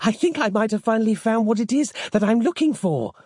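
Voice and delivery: high-pitched, panting excitement; panted. A narrator's reading. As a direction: keep it high and urgent, as if panting. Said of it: "panted Samantha".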